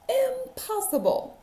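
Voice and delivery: high, outraged cry of disbelief; can't believe what he's hearing; a farmer's shocked exclamation. Acted. Farmer can't believe cows type.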